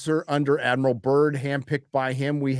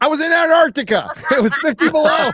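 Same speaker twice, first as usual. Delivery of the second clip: loud, high-pitched comic yell; hammed-up high wail of protest; mock-defensive protest; 5 dB louder than usual. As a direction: shout excuses in exaggerated indignation. Mock excuse about shrinkage.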